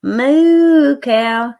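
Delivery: loud, drawn-out "Moo cow"; sing-song moo cow. Retold or imitated. Grandma showing the baby the cow picture, loud sing-song moo cow. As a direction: stretch "Moo" out big for the toddler. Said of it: "I'll say".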